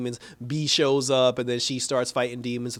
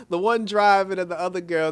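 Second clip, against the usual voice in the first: ordinary talk, nothing marked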